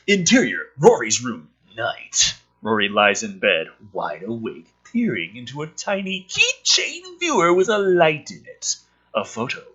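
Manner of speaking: script read with rising drama, big on the reveal; punchy storyteller read; dramatic script reading. Acted. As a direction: read it like a trailer, build to the last word. Reading screenplay aloud dramatically.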